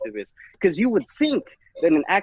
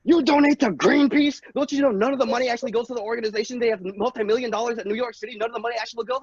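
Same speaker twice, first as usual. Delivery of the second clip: scolding friends; 7 semitones higher, faster than usual. Acted. Friends voiced lecturing.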